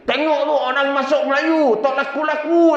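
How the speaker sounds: high-pitched jeering call; mock shout, pitched very high; mock yell. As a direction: imitate the aunt hollering gossip to the village. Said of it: "dia teriak lagi".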